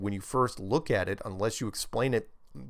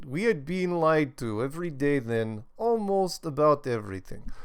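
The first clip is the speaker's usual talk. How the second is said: higher, hammed-up Russian accent; Soviet accent; 4 semitones higher than usual. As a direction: read it like a Soviet emigrant. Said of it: "all right, I'll stop".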